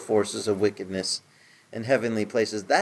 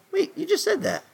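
much higher, mock-startled objection; a pretend surprised reader; mock surprise. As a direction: play the puzzled listener catching a repeat. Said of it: "mock listener objection".

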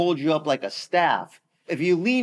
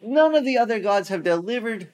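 bragging taunt; 7 semitones higher than usual. Retold or imitated. Assyrians calling out to city, high bragging taunt. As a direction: crow over the city from the wall. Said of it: "That's how they were talking".

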